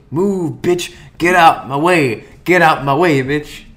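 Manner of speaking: loud, slow bossy robot. Retold or imitated. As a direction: bark it like a rude robot butler. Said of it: "when the Cogsman robot goes".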